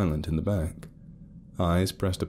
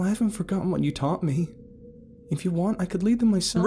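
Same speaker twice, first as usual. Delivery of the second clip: higher, timid pleading; Ruth's voice; 12 semitones higher than usual. A narrator's reading. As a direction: gently urge her dad. Ruth pleads to lead.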